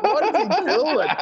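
high, drawn-out mock bafflement; amused disbelief. Storyteller watching his tennis opponent flail, amused and baffled. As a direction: retell it slow and high, full of amusement. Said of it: "And I'm laughing".

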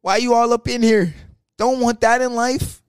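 pushed to the edge, fire it back; retold angry outburst. Yelling at the GM to stay out of his business, angry. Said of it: "he pushed me to the edge".

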